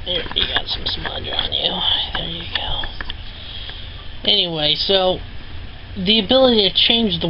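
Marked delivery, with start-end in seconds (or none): 0.1-2.8 softer, unvoiced whisper to someone nearby; whispered aside. Real, not acted. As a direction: murmur it privately off-mic. Quiet aside wiping off smudge.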